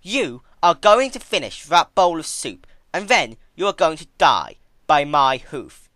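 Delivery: slow, high and loud icy verdict; cold Twilight. Acted. Twilight informing the crying princess, icy and loud. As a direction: deny her flatly, from above.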